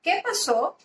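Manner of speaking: "¿qué pasó?" with a clear rising question tune. Shown as a demonstration. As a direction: ask "¿qué pasó?" lifting the pitch, puzzled. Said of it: "O cuando hacemos una pregunta".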